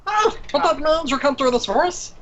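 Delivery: high, squeaky gnome voice. Acted. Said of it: "This isn't an Irish accent".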